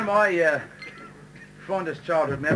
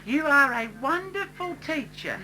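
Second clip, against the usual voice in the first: higher, slow, sugary pupil; butter up the teacher sweetly; mock-sweet teacher's pet; sweet schoolgirl; 7 semitones higher, slower than usual. [name] sucking up to teacher.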